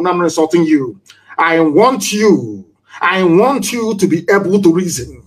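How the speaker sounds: normal talking voice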